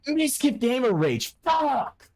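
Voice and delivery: high, drawn-out furious outburst; gamer rage. Real, not acted. Streamer loses everything in his game and rages out high. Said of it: "I'm having, like, gamer rage".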